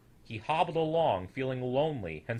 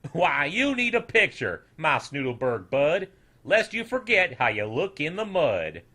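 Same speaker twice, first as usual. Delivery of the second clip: louder, folksy teasing voice; mocking farmer; 4 semitones higher, 6 dB louder than usual. A narrator's reading. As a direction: tease him as you paint.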